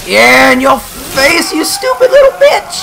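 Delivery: gloating shout breaking into a squeal; voice cracking. Real, not acted. Gamer gloating at the boss, voice cracking shout. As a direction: yell so high and loud the voice cracks. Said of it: "Oh, my voice cracked".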